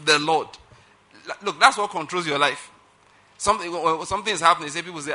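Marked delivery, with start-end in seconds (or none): none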